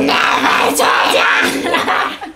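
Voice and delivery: very slow, raspy mock shout; melodramatic yell. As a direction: bellow it drawn-out and overblown, nearly laughing. Same line, now a hammed-up yell.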